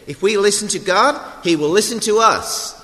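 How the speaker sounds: imitated preacher's accent, pitched high and loud; Bob Lloyd. Quoting a much-loved preacher to the congregation, in his high, loud accent. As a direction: put on the preacher's accent, lifted high and forceful. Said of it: "in that sort of accent".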